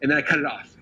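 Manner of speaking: usual speaking voice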